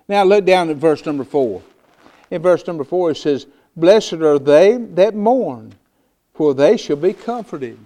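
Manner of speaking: loud, stretched-out pulpit delivery; fiery preaching. Acted. Pastor preaching to his congregation, loud and fervent. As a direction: preach it to the back pew. Preacher reads scripture at full force.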